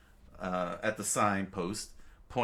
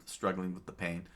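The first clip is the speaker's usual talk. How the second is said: plain reading voice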